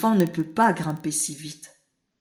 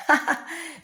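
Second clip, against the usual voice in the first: rire bref et aigu; petit rire aigu; 11 semitones higher than usual. A narrator's reading. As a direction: rire gentiment de la surprise. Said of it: "rit le rat". (le rat rit doucement)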